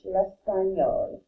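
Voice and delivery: L'espagnol drawn out slowly, each syllable clear; slow classroom model of L'espagnol; slow model word. Shown as a demonstration. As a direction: say L'espagnol slowly for students to copy. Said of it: "is how they say Spanish".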